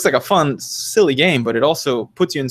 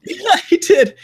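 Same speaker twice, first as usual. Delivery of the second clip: laughing; 5 dB louder, slower than usual. Real, not acted. Laughing excitedly about game.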